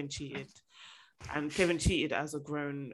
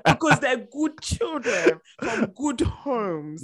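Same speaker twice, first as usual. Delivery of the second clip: mock solemn; 6 semitones higher, 6 dB louder, much slower than usual. Mock-solemn praise, slow.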